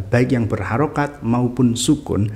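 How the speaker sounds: normal talking voice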